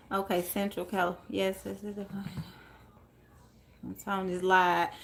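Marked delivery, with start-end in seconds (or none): none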